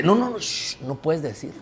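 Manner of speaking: soft, hurried shushing; urgent hush. Retold or imitated. Guide shushing the pastor for saying bacon, urgent hush.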